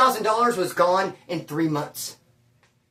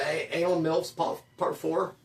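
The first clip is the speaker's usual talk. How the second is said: softer, halting, reading the title out reluctantly; embarrassed mumble; 3 semitones lower, 6 dB quieter than usual. Retold or imitated. Embarrassed customer mumbling a movie title to the clerk. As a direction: mumble the title like you're ashamed of it. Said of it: "this is embarrassing as hell".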